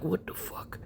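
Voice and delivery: whispered, barely audible disbelief; baffled whisper. Retold or imitated. Dad finding nobody in his daughter's room, baffled whisper. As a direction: whisper it to yourself. Muttering to himself, finds nobody there.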